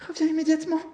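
very high, loud command; crying with all strength. A narrator's reading. Mother calling her son back, desperate shout. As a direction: scream the order after him. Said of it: "cria sa mère de toutes ses forces".

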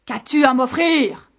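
loud, demanding owl-monster; monster's shout. A narrator's reading. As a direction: bellow the demand at the shepherd. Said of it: "cria de nouveau le monstre". (voix forte de monstre exigeant)

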